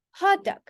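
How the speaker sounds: Hot dog with Hot high, dog dropping; Hot dog as one compound, HOT-dog; stress on HOT. Shown as a demonstration. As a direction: hit Hot high, keep dog short. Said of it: "we pronounce it quite differently".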